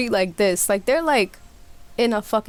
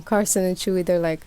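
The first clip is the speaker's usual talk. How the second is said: usual speaking voice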